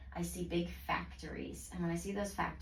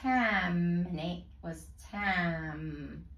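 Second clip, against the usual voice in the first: slow, pointed out; much slower than usual. Shown as a demonstration. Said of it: "Look at that middle syllable there".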